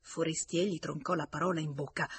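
plain reading voice

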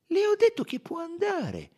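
very high, loud and firm; high-voiced, impatient detective; insistent dismissal. A narrator's reading. Poirot repeats dismissal to lingering suspect.

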